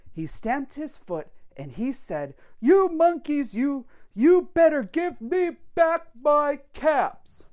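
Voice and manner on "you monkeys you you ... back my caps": loud, higher read-aloud anger; angry peddler. Acted. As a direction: shake both fists and scold. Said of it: "Now he felt quite angry".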